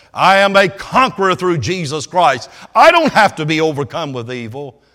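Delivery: loud, high, rousing preacher's climb; full-force preaching. Acted. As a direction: lift the crowd up with fervour.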